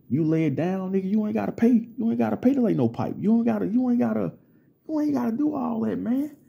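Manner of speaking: retold heated lecture.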